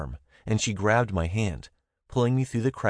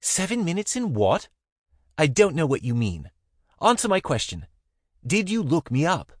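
high, louder flustered woman's voice; Zara, flustered; 9 semitones higher, 4 dB louder than usual. A narrator's reading. Zara snapping at him in the closet, flustered and high. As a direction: demand an answer, flustered. Zara confused then demanding.

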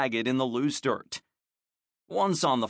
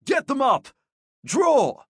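high, loud drill shout; 12 semitones higher, 7 dB louder than usual. A narrator's reading. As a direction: bark the drill across the field. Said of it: "Alario called out".

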